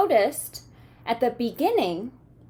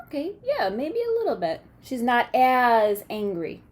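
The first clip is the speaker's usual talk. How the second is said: ordinary delivery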